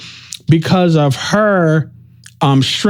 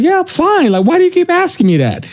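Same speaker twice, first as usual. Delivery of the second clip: much higher and fast, puzzled; friend's brush-off; 13 semitones higher than usual. Retold or imitated. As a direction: play the friend shrugging off the question. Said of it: "And he was like".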